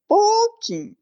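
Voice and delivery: a high, teasing "pouquinho" that means the opposite; ironic. Acted. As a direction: pitch it way up, tongue in cheek. Ironic, they talked a lot.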